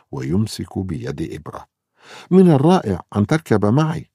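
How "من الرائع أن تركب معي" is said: high, loud delighted cheer; bright happy outburst; cheerful. A narrator's reading. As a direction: sound thrilled to ride along. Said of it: "يقول إبرا مبتهجا".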